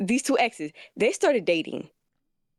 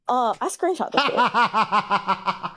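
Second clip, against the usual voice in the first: laughing; much slower than usual. Real, not acted. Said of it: "Okay, okay".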